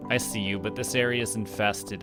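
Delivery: usual speaking voice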